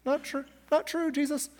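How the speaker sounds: high, small sing-out aside; teasing correction. Acted. Preacher playfully contradicts Jesus, high-pitched aside.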